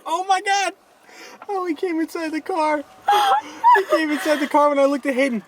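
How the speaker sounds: high squealing shock, fast; startled yelp at the animal. Real, not acted. Animal came inside car.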